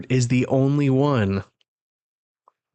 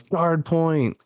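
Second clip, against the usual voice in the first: mock yell; 6 semitones higher than usual. Reading how Chopper yells his move, mild mock yell. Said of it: "he makes it to him and yells".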